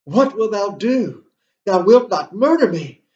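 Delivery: loud, high-pitched cry of alarm. Acted. Lecturer acting Gertrude confronted by Hamlet, crying out. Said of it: "that Gertrude cries out".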